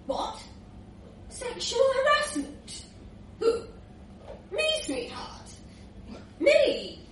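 high-pitched disbelief in short bursts; incredulous. Acted. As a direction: react as if wrongly accused. Rudolph reacts to the accusation.

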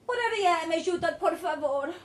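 loud, high pleading voice; urgent begging boy. A narrator's reading. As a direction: beg loudly and urgently. Boy begs in foreign language.

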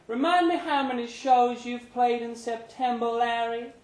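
a pointed, probing landlady tone; Brenda's voice. A narrator's reading. Narrator voicing Brenda as she quizzes Larry.

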